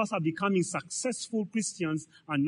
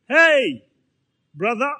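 mock-hearty hey, higher and louder; fake friendly greeting; 5 semitones higher, 7 dB louder, slower than usual. The preacher imitating a hypocrite friend who greets you warmly once you have money, in a hammed-up hearty voice. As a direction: play the fair-weather friend who suddenly turns up.

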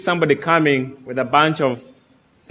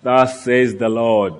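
movie God voice; 3 semitones lower than usual. Retold or imitated. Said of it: "God is portrayed as with this deep voice".

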